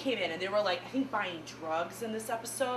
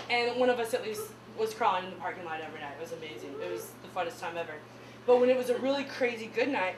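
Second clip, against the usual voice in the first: ordinary delivery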